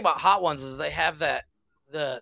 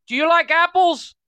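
high, loud shout through glass; imitated window shout; 12 semitones higher, 10 dB louder than usual. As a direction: yell it like you're banging on the window. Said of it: "He literally bangs on the window".

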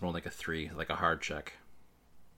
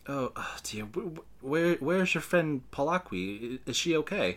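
high, halting, worried voice; worried NPC; 7 semitones higher than usual. Acted. Vitric frets to the players, high and stammering. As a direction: stammer out concern for her friend.

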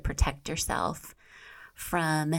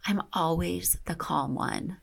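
ordinary delivery, nothing marked

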